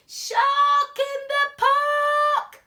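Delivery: very high, loud, sung-out slow shout; shout at the top of his voice. Acted. Boy spotting the shark, shouting at full voice. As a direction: belt it like a warning siren. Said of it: "He shouts".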